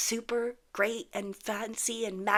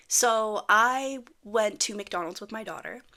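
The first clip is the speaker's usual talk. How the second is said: normal talking voice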